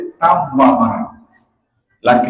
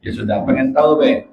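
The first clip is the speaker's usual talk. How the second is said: ordinary talk, nothing marked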